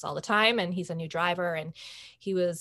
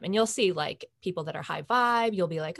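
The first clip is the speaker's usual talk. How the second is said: usual speaking voice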